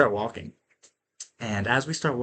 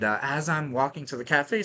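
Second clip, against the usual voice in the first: ordinary talk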